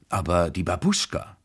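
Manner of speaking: ordinary delivery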